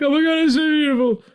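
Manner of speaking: high, loud, swooning gush; overjoyed high-pitched gushing; gushing fan. Retold or imitated. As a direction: squeal it like a moved fan. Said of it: "they were like".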